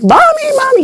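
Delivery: very high, squeaky imitation of a child; eager child calling. Johannes calls mommy to see the knife, eager child voice. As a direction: call out like a kid wanting mom.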